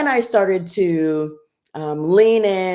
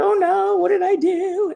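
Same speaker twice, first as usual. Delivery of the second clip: high, sing-song wail of panic; mock anguished wail; 13 semitones higher than usual. Mother retelling her own panic, mock anguished high wail. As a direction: wail it like a hammed-up lament.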